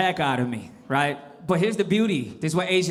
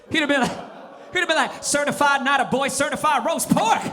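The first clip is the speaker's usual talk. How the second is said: hyped dad; 7 semitones higher than usual. Retold or imitated. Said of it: "my pops would've got mad hype".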